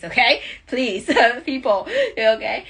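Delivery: loud, laughing plea to be careful. Real, not acted. Host warning viewers not to close eyes in the street, laughing loudly. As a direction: laugh while begging them to stay safe.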